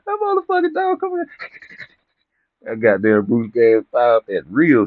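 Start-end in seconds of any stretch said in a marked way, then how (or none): 0.1-1.2 high voice breaking into laughter; can't hold the laugh in. Real, not acted. Laughing at own story.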